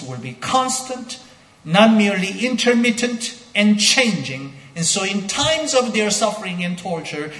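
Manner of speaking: loud, high, fervent sermon delivery; ringing pulpit proclamation; preaching at full force. Acted. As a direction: preach it out to the whole church.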